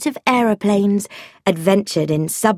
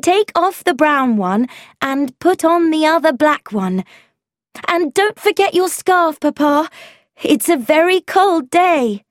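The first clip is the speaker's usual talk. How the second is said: calling out; 8 semitones higher than usual. Acted. Calling after departing father.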